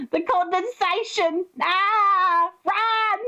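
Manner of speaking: high, drawn-out melodramatic cries; mock-panicked calling. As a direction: call out names in hammed-up alarm. Same hammed-up alarm impression.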